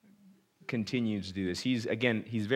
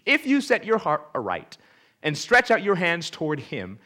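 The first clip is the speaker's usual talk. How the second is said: loud, high preaching read; scripture reading; 5 semitones higher, 7 dB louder than usual. Acted. Preacher reads Zophar's counsel to Job, loud and lofty. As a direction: proclaim it from the pulpit.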